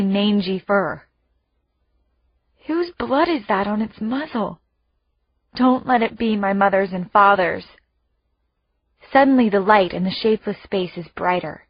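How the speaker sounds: plain reading voice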